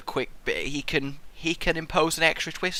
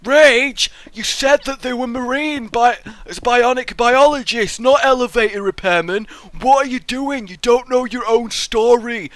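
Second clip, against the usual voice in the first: louder, higher nagging commenter; mock complaining viewer; 5 semitones higher, 5 dB louder than usual. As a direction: mimic annoyed fans. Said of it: "people were like".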